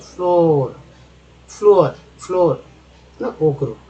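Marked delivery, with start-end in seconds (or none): 0.0-2.6 फ्लोर said loud and drawn out, oo-like vowel; model word floor. Shown as a demonstration. Teacher drills फ्लोर for learners, loud and clear. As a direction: repeat फ्लोर with a long ऊ. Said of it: "फ्लोर में double ओ का उच्चारण ऊ के रूप".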